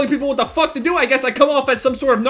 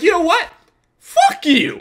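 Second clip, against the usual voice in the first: higher-pitched retold outburst, pause before the insult; going off on someone; 7 semitones higher, slower than usual. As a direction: re-enact losing it at a teammate. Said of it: "I just went off on the dude".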